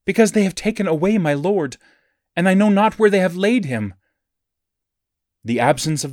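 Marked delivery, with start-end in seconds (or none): 0.0-3.9 raised, pleading voice full of grief; a mourner's anguished lament, pitched up; sorrowful plea. A narrator's reading. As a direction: cry it out as a grieving woman, higher. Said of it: "voice anew her overwhelming sorrow".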